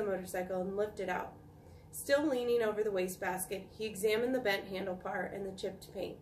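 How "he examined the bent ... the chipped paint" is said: plain reading voice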